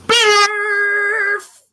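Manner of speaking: high, loud, stretched-out silly word; loud nonsense noise. Acted. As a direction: belt out pure nonsense. Book forces her to say nonsense.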